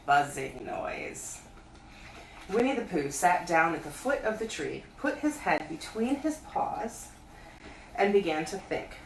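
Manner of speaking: plain reading voice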